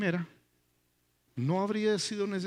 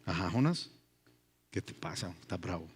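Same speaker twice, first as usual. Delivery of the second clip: much lower and softer, calm and knowing; God's voice; 11 semitones lower, 6 dB quieter than usual. Acted. God gently questions the angry prophet, low and soft. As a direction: speak as a patient God, low and hushed. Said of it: "le dice Dios".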